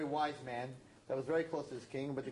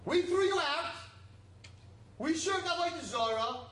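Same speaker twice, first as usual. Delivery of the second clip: much higher, louder confession of guilt; impassioned preaching; 13 semitones higher, 4 dB louder than usual. Real, not acted. Preacher confessing the people's sins to God, impassioned. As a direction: cry it out to the congregation.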